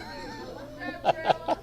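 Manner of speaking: high burst of laughter; laughing. Real, not acted. Teacher amused by a pupil yelling a name, laughing.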